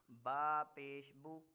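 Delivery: slow teacher's recitation of a Qaida drill; slow model recitation. Shown as a demonstration. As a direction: recite slowly for the student to copy. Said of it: "teacher models for repeat".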